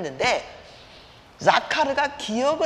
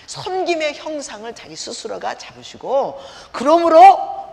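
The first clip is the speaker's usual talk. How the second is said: fervent preaching; 5 semitones higher, 6 dB louder than usual. Acted. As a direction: preach it with rising fervour. Preaching at full force.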